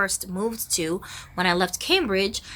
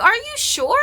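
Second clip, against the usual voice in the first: put-on shocked kids; 14 semitones higher, 4 dB louder than usual. Kids crying after death news.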